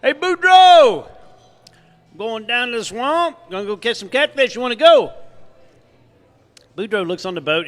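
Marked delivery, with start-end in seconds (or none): 0.0-1.1 high, slow drawn-out call across the bayou; hollering to a friend from a boat; stretched, much higher and louder shout; Thibodeau calling out. Acted. 2.1-5.1 raised, higher Cajun buddy pitching a trip; lightly voiced fisherman; Thibodeau voice. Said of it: "Thibodeau yells at him".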